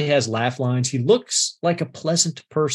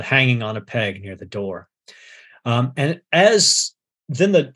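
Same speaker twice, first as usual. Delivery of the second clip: ordinary talk, nothing marked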